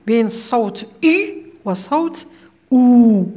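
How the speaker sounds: أو then أو, the two vowel sounds set apart; the teacher sounds out أو against أو so pupils hear the contrast. Shown as a demonstration. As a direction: make the difference between أو and أو clear. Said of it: "كاين خلط".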